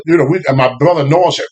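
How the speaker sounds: usual speaking voice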